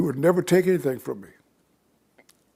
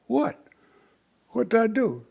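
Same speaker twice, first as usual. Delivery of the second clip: high, alarmed question; startled what; 9 semitones higher than usual. Retold or imitated. Son caught off guard by his father's threat, high.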